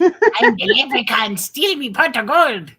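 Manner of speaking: a touch of an Irish leprechaun brogue; playful Irish leprechaun voice. Pretend. Jokey leprechaun voice, far timbre.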